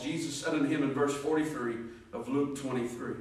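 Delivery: ordinary talk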